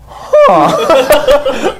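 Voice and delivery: loud, high mock gasp; huge startled intake, played for laughs; shocked gasp. Gamer as the whole house goes dark, shocked gasp.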